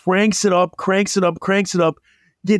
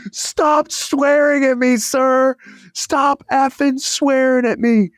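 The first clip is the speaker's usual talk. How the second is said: much higher mock yell; yelling kid; 9 semitones higher than usual. Roofer kid yelling back at the swearing owner, mock yell. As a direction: imitate a young worker yelling back, still polite. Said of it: "the kid's like".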